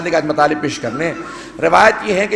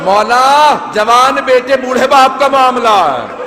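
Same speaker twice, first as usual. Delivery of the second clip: higher, louder, slow grieving cry; cried-out lament; 5 semitones higher, 4 dB louder, slower than usual. Acted. Preacher crying out what nobody said to the Imam, slow loud lament. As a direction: wail the line as a mourning cry.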